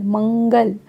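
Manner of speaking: ordinary talk, nothing marked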